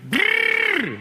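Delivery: a shrill little animal growl; squeaky copied growl; high mock growl. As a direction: trill it up top like a strange noise. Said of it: "she goes".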